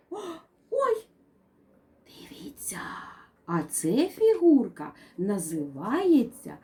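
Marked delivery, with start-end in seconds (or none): 0.1-1.1 mock surprise. Surprise at cube result. 2.1-2.8 breathy secret whisper. Acted. Teacher draws the children in with a secret whisper. Teacher hushes kids to look.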